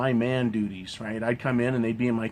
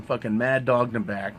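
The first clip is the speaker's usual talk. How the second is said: ordinary delivery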